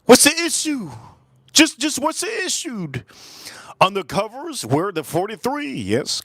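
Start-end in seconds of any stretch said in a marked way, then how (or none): 0.1-2.9 high-pitched mock outburst; mock questioning. Streamer answering a chatter's gun comment, high mock questioning. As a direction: squeak it out in exaggerated disbelief. Mock reaction to chat message.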